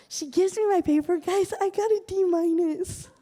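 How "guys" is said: ordinary talk, nothing marked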